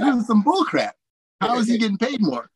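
high, indignant mock rant; exasperated disbelief. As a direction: throw up your hands at the unfairness. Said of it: "I'm like".

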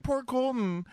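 very high-pitched sympathetic coo; pitying coo. Retold or imitated. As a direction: coo it high with exaggerated pity. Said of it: "'cause I was like".

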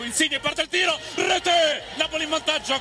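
high shouted goal call. Real, not acted. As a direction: yell the goal, pitch soaring. Goal scored in highlights.

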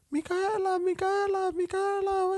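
put-on very high squeaky child voice; little girls' voices. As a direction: call her name like excited little girls. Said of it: "talking a hundred miles per hour".

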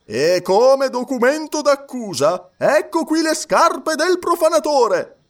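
high, slow, loud presentation of evidence; triumphant clerk. A narrator's reading. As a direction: produce the proof with relish. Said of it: "soggiunse il cancelliere". (cancelliere trionfante, acuto e lento)

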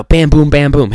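loud punchy beats; punchy bam boom. Shown as a demonstration. Telling storytellers to cut to the point, punchy. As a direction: hit each word like a punch. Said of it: "capture them with the point".

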